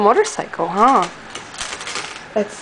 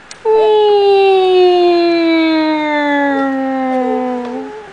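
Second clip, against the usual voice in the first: lip buzz airplane; 4 semitones higher than usual. Pretend. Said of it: "You don't like the airplane?".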